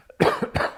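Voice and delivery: sharp dusty cough; dry cough. Real, not acted. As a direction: cough once, harshly. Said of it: "apologize for my coughing".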